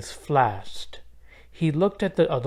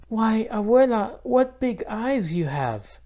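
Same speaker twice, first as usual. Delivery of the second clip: put-on wondering little girl; 9 semitones higher than usual. A narrator's reading. Isabel questioning the disguised wolf.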